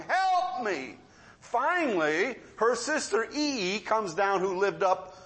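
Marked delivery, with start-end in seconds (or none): 0.1-1.0 higher mock yell for help; retold cry for help. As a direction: imitate her calling out for help. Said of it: "she's crying".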